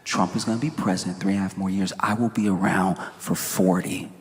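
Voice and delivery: low, softened mock menace; imitating a threat, low and controlled; veiled threat. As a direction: read it out as a quiet, low warning. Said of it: "hear the threat in it when you read it".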